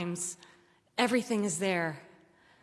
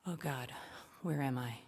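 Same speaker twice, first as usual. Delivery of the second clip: much softer and lower, a scared murmur; the dread sinking in, barely voiced; frightened hush; 5 semitones lower, 10 dB quieter than usual. Alone with Jake in the dark woods, frightened hush.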